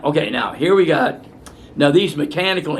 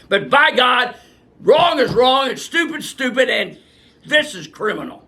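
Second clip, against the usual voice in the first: real fury rising to a shout; indignant shout; 8 semitones higher than usual. Real, not acted. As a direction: hammer home that they are wrong. Indignant rant peak.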